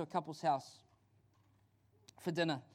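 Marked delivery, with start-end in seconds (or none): none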